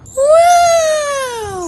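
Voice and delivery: high amazed shout; excited shout. Real, not acted. Awe at the snow.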